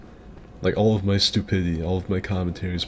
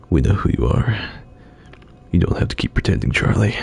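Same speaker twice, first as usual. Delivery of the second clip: knowing conspiracy fan; 4 dB louder than usual. Retold or imitated. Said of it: "come all the way around to".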